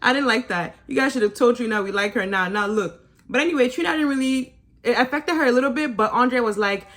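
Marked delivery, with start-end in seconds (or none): none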